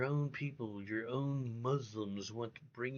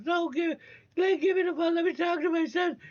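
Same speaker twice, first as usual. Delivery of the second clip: very high, louder mock mother; imitated mother's voice; 8 dB louder, faster than usual. Mother in the background demanding her son, imitated in a very high, louder voice. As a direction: squeal it like an eager mother grabbing the phone. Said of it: "you could hear the mother, you know, in the background, says".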